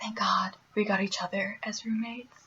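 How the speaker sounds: soft unvoiced whisper; whispered relief. Roommates to each other, whispering thankful relief. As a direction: breathe it like a shared secret.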